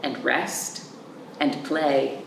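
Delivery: put-on gentle read-aloud for kids; storybook reading. Teacher reading a picture book to children, gentle storybook voice. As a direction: read the picture book slowly to little ones.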